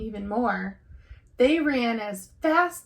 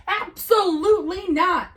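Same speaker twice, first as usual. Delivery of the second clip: higher, louder roar of refusal; 8 semitones higher, 7 dB louder than usual. Acted. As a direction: bellow it like an angry bear. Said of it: "roared Papa".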